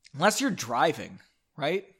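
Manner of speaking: usual speaking voice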